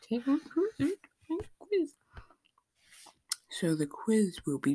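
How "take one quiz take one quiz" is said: high whiny voice, rising; squeaky complaining voice. Acted. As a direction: whine it in a squeaky voice. Mock complaint about quizzes.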